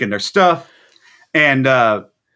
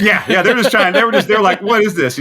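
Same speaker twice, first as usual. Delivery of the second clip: laughing while talking, higher and louder; 6 semitones higher, 3 dB louder than usual. Real, not acted. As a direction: let the laugh spill into the words. Laughs agreeing about bus.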